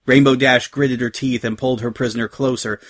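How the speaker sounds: ordinary delivery, nothing marked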